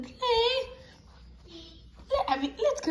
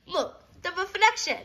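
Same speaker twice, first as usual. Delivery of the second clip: ordinary delivery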